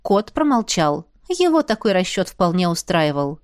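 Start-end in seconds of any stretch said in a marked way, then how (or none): none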